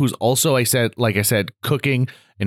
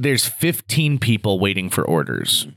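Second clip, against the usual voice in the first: normal talking voice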